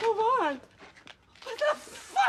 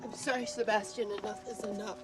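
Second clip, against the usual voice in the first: low, softer weary apology; defeated; 10 semitones lower, 7 dB quieter than usual. Acted. Actress quitting on Sebastian after the row, defeated. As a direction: give up quietly, drained.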